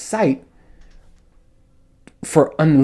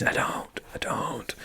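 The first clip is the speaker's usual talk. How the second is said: whisper; 16 dB quieter, slower than usual. Real, not acted. Joke about own size.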